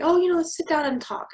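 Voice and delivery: high, fast, sugary mock of a nice lady; imitating the landlady. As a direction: chirp it like an overly friendly host.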